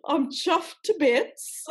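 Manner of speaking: slow, exaggerated British accent, pitched high; hammed-up British attempt. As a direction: stretch it out in amateur British. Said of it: "trying to do Cockney".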